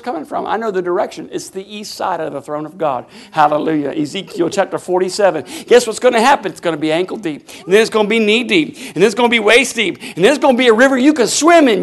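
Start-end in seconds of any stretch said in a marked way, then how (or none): none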